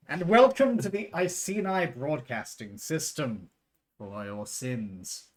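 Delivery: slow, grand broadcast intro; announcer voice. Acted. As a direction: welcome them like a TV host. Broadcast-style show intro.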